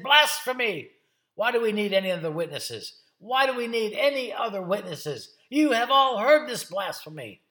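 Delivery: loud, high cries of accusation; horrified outrage. Acted. High priest tearing his clothes at Jesus's answer, horrified outrage. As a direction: denounce him to the whole court. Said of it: "He was horrified".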